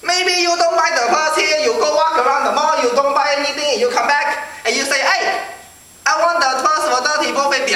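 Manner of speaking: put-on high Chinese-accented shopkeeper lecturing. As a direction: argue it out in a mock Chinese accent. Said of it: "he totally started telling me this thing".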